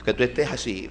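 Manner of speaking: ordinary talk, nothing marked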